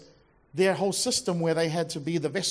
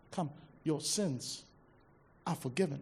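tender; 5 semitones lower, 8 dB quieter than usual. Acted. Repeated tender Jesus quote.

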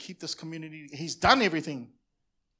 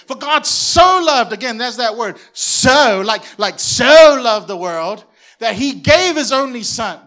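much louder, slow, stretching the word so; fervent preaching shout; 6 semitones higher, 19 dB louder, slower than usual. Real, not acted. Pastor driving home John three sixteen, slow loud preaching. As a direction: shout it out with passion.

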